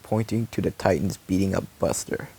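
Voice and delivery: usual speaking voice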